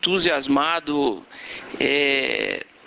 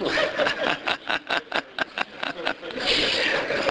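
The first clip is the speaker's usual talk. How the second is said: long chuckling laugh, trailing off; real amused laughter; hearty laugh; 3 semitones higher, 4 dB quieter than usual. Real, not acted. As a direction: laugh heartily at your own quip. Joking about including his team.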